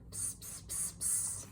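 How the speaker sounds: hushed unvoiced psst psst; whispered psst. Lovelock secretly whispering to every umlaut, hushed psst. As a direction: hiss it secretly.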